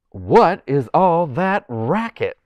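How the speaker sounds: a puppet king with a light Southern accent; slow prim Southern accent for a puppet; Southern King Arthur voice. Shown as a demonstration. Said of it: "like, with a little bit of a Southern accent".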